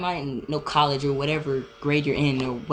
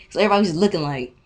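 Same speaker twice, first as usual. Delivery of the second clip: normal talking voice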